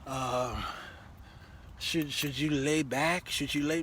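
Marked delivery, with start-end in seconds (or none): none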